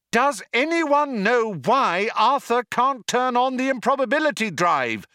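high, urgent yell; shouting. A narrator's reading. Trillian appealing to the crew, high shout. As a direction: call out urgently over the din. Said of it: "shouted Trillian".

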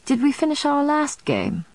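mildly surprised question, pitched high; feigned surprise. A narrator's reading. Vampire asks his friend about the tidy board, feigning surprise. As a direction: lift the pitch in mock surprise. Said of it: "feigning surprise at the neatly arranged board".

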